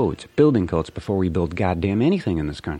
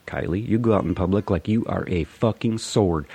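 ordinary delivery

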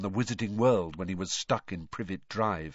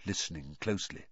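ordinary delivery, nothing marked

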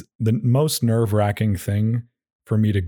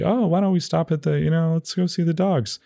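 lightly higher, breezy imitation of a weekend visitor; mock casual dog owner; 7 semitones higher than usual. Mocking weekend visitors at the dog park, breezy. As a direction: mimic the once-a-month novelty crowd, pitched up. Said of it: "like, a little novelty".

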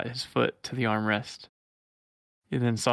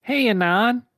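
high, louder cartoon Sonic greeting; 12 semitones higher, 5 dB louder than usual. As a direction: chirp it like a grinning cartoon hedgehog. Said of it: "he said, smiling".

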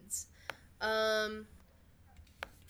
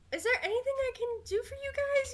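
bubbly Skyla voice; 11 semitones higher, faster than usual. Acted. Skyla speaking to guests.

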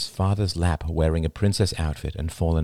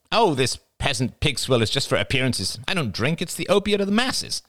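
high nasal voice climbing higher; Jada, haughty; 9 semitones higher than usual. A narrator's reading. Jada waving her beer bottle, high disdainful voice. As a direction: pronounce it grandly, pitched high.

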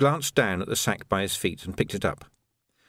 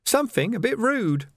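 sniggering; 12 semitones higher than usual. A narrator's reading.

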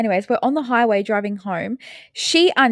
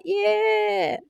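mock careless driver; 11 semitones higher, 4 dB quieter than usual. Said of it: "he's like".

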